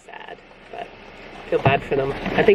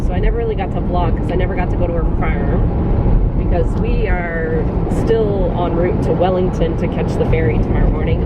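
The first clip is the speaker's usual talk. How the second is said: ordinary delivery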